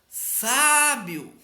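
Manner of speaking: SÁÁÁ-bio, alto e agudo; penúltima sílaba forte. Shown as a demonstration. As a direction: chame Sábio esticando o SÁ. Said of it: "a penúltima sílaba é a mais forte". (Sábio com SÁ forte e demorado)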